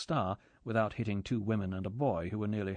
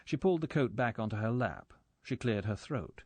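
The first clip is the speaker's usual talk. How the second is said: plain reading voice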